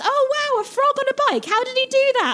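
very high, gushing mock amazement; mock impressed. Mimicking a crowd amazed by a frog on a bike, mock impressed. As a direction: coo in exaggerated awe. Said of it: "everyone was so impressed".

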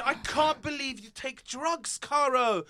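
high shrill outraged shouting. Acted. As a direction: scream it out, scandalised. Stacy shouting at drugs.

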